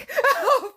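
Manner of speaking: sudden high-pitched laugh; high burst of laughter. Real, not acted. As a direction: break into a squeaky laugh. Laughing at McDonald's lookalike.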